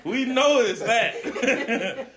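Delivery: high, drawn-out words through laughter; laughing. Real, not acted. Host cracking up with the panel, laughing.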